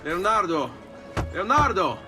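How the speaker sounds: calling out. Acted. Visitor looking for Leonardo, calling out.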